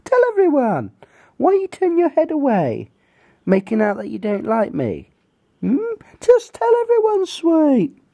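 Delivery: very high cooing voice to a pet. Real, not acted. Baby talk to the dog.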